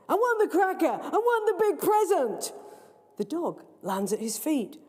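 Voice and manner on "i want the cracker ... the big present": put-on high, loud greedy child; demanding boy. Greedy boy demanding his present, high loud. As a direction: whine it like a spoilt boy.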